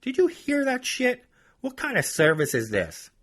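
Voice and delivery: hammed-up ranting woman; ranting customer. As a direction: mimic her griping on the phone. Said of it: "She ranted about me".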